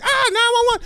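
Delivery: high-pitched panicked yelp; mock panic. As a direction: shout it like a startled 911 caller. Acts out scared sketch character.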